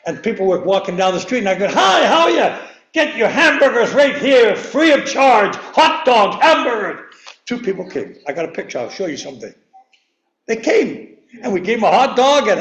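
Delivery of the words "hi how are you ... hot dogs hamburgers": hawker call. Retold or imitated. Preacher calling people to the church barbecue like a vendor. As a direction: pitch free burgers like a ballpark seller. Hawking free food at barbecue.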